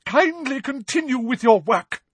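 high, indignant teacher's command; angry order. A narrator's reading. Professor ordering her class back to work, high and indignant. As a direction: dismiss them in a high, offended voice.

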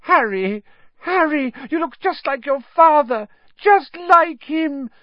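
very high, loud, squeaky wheedle; high desperate flattery, slowing at the end; fawning plea. A narrator's reading. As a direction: plead in a high coward's voice. Pettigrew pleads with Harry.

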